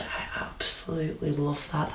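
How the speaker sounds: breathless whispered awe; hushed, breathy adoration. Real, not acted. Hypnotised subject adores hat.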